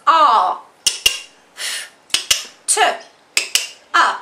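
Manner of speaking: a, f, t, a with pauses between sounds; sounded out. Shown as a demonstration. Teacher sounding out after for pupils. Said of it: "taking a picture of those sounds".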